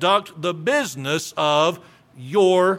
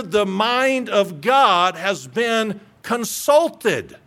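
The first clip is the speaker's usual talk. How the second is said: forceful preaching; 2 semitones higher, slower than usual. Acted. Preacher admonishes young ministers.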